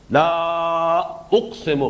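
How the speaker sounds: لا held long before اقسم; long sustained لا, separated from اقسم; stretched لا. Shown as a demonstration. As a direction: draw out the vowel of لا, then say اقسم. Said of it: "اس لا کو کھینچنا چاہیے".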